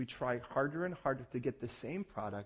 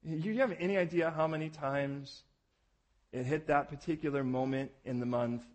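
ordinary delivery

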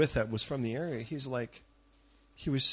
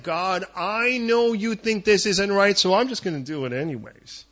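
louder mock defiance aimed at God; defiant to God; 5 semitones higher, 8 dB louder than usual. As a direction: spell it out stubbornly to God. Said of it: "Sometimes it's like".

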